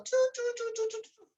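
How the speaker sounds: high, soft mimicked "chu chu chu" noises; playful sound effect of rising talk; mock rising chatter. Shown as a demonstration. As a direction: imitate voices creeping up in pitch.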